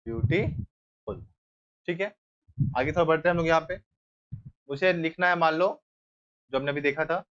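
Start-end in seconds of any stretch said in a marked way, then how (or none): 0.1-1.2 beautiful drawn out very slowly and low; slow dictation. Shown as a demonstration. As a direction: stretch the word as you finish writing. Teacher writing the answer. (बहुत धीमे, खींचकर)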